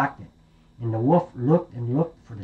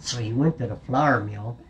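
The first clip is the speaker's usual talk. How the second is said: usual speaking voice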